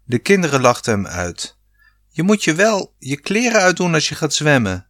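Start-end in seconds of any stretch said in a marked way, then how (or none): 2.1-4.9 high mock-scolding yell, understated in volume; teasing shout. A narrator's reading. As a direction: tease dad, pitched high. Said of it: "schreeuwde Rob". (plagerig hoog geroepen)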